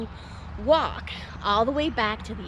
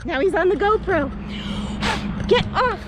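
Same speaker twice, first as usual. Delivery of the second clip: frantic yelling; 7 semitones higher than usual. Real, not acted. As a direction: shoo the spider off frantically. Spider crawls onto camera.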